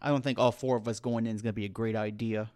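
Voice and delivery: low, soft, resigned game character; subdued in-character reply; Roscoe. Acted. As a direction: keep it quiet and low, after a sigh. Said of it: "Roscoe sighs and looks at Rovarth and Flint".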